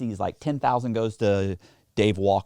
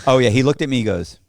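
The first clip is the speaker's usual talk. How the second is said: ordinary talk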